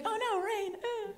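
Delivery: hammed-up prissy gasp at rain; mock dismayed date. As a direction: squeak it like a prim lady in the rain. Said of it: "And she's like".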